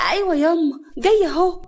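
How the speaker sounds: high, loud answer from the stairs; calling back. A narrator's reading. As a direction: call back that you're coming. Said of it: "هنا من على السلم".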